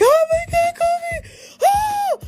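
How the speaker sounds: very high, shrieking through tears; retold crying scream. Fan retelling her reaction to Kofi's win, high tearful shriek. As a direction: relive the moment, screaming and sobbing with joy. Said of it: "I was like".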